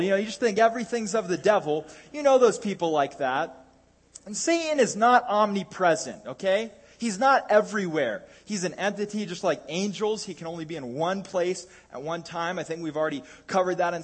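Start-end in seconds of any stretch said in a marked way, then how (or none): none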